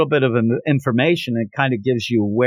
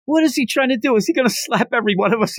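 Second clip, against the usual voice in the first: mock yelling; 8 semitones higher, faster than usual. Team reacts to being slapped, mock yell. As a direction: imitate a crowd's alarmed outcry. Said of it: "they're all yelling".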